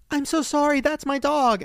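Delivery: high, apologetic woman; apologetic owner. Retold or imitated. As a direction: say sorry in a high rush. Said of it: "she's like".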